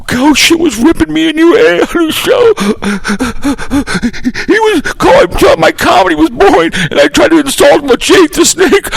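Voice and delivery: high whiny fake-crying voice; mock crybaby whine. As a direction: whimper like a tattling baby calling the boss. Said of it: "run off like a big baby".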